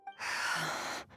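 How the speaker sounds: long voiceless sigh; weary exhale. Acted. Skirk finishes her training, long breathy exhale. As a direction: breathe out slowly after effort.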